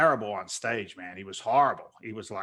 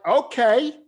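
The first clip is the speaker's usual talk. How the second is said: high, loud comic okay; exaggerated startled agreement; amazed acceptance; 11 semitones higher, 8 dB louder than usual. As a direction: agree in disbelief, voice shooting up. Retold reaction to the offer.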